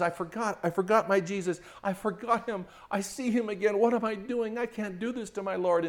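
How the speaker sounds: a touch of a weeping wife; crying wife. Retold or imitated. Wife realizing she forgot Jesus, mildly tearful. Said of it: "She says".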